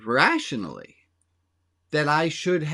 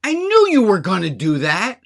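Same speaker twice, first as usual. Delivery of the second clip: raised, louder accusing jab; mock confrontation, higher and louder; 4 semitones higher, 8 dB louder than usual. Wife imagining confronting him about the dishes, accusing. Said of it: "because I could say to him".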